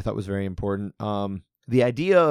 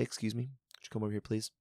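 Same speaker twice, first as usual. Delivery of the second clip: softer, gentle and polite; calm; 12 dB quieter than usual. Retold or imitated. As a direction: invite him over calmly. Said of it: "calmly say".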